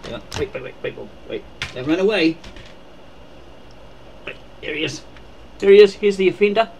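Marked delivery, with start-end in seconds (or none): none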